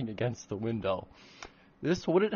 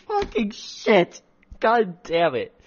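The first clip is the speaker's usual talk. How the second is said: embarrassed cursing; 7 semitones higher, 6 dB louder, slower than usual. Real, not acted. Author cringing at own fanfic.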